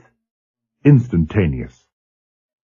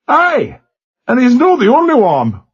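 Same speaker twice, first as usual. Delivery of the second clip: put-on defiant Glasgow accent, higher and louder, faint tremor beneath; truculent Glasgow lad; 4 dB louder than usual. A narrator's reading. Young gunner defying the captain in a truculent Glasgow accent. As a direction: brazen it out in Glasgow Scots, hiding the shake. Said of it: "The voice was almost truculent".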